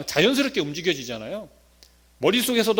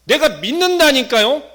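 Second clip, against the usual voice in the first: slow, loud, higher protest; insistent claim; 8 semitones higher, 10 dB louder than usual. Acted. Believer insisting to Jesus, loud and slow. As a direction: lean hard on every word.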